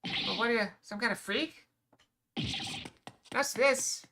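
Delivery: loud alarmed disbelief; panicked. Acted. Attacker faces unkillable dragon.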